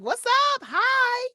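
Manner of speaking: very high, loud what's up; bubbly high loud hi; excited greeting. Shown as a demonstration. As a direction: burst out with a bright greeting. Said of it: "I'm like".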